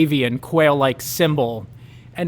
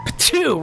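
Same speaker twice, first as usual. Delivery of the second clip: high spat-out "p'too"; bully spitting in disgust; spitting sound; 10 semitones higher than usual. Acted. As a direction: spit it out in disgust. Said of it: "Roger says".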